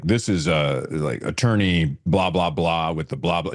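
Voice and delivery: mock the lawyer's dull official voicemail tone; mimicking the attorney. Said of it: "He sounded like".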